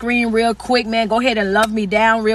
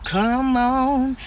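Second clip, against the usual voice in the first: drawn-out churchy call to come on; old-school church come on; 3 semitones higher than usual. Shown as a demonstration. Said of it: "They used to hit you with that "come on".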